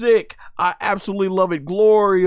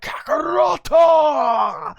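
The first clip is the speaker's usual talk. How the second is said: Vegeta's yell; 9 semitones higher, much slower than usual. Retold or imitated. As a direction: stretch the name into a furious bellow. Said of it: "Vegeta's like".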